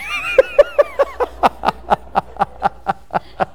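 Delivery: long high laugh; high burst of laughter. Real, not acted. Preacher laughing at a congregant's quip, high-pitched. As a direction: crack up at the joke.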